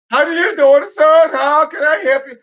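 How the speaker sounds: mock Indian accent. The clerk repeating himself to the confused customer, mock Indian accent. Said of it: "how they speak, like they're underwater".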